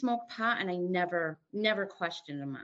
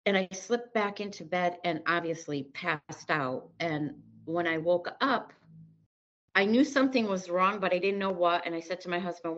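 normal talking voice

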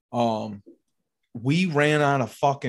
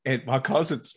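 ordinary talk, nothing marked

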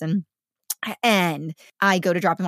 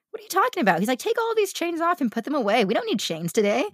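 high mock friend voice; 8 semitones higher than usual.

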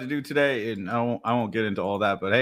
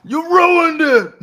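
high, loud, slow wail; mock dismay voice; 14 semitones higher, 8 dB louder, slower than usual. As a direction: wail it like a heartbroken sender. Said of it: "It's like".

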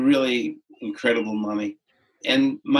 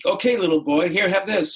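put-on friend coaxing a child; 7 semitones higher than usual. Friend offering candy to the boy.

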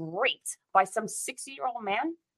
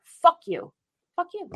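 angry outburst; 8 semitones higher than usual. Real, not acted. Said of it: "And rants, both of us".

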